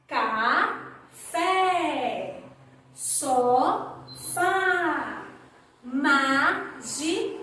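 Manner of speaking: café, sofá, mágico with the accented vowel held wide open; teacher modelling open vowels; open vowel demo. Shown as a demonstration. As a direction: stretch the open é and á, slowly. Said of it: "Repitam comigo".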